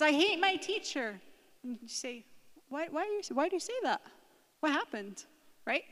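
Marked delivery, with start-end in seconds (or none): 0.0-1.2 higher, louder acted outburst; upset child complaining about school, voice raised; angry. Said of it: "She's so angry". 2.7-5.2 high-pitched, concerned parent asking; gently puzzled, pitch lifted to invite an answer; curious. Acted.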